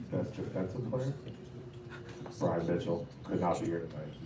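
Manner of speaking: ordinary delivery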